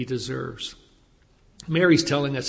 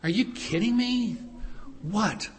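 higher, mock-exasperated "are you kidding me"; incredulous scolding; 4 semitones higher, 3 dB quieter than usual. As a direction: scold her in disbelief, lightly acted. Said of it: "in more modern parlance".

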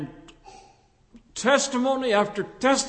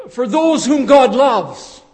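loud hypothetical quote; 3 semitones higher, 11 dB louder than usual. Shown as a demonstration. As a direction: throw out the misquote loudly, stressing "God". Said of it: "why didn't Paul say".

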